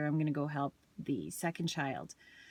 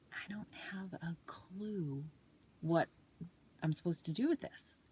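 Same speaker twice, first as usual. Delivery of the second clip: breathy hushed thought, much softer; whispered inner panic; 6 dB quieter than usual. Retold or imitated. Mom stuck on grade three math, whispering to herself. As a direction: whisper the thought to yourself in disbelief.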